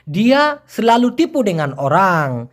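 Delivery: very slow, even Malay sentence; slow Malay model phrase. Shown as a demonstration. Teacher repeating Malay sentence for class, very slow. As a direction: lay out each Malay word slowly. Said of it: "মালয়েশিয়ানরা এভাবে বলবে".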